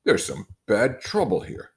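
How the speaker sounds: excitedly. Acted. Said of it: "said Tom excitedly".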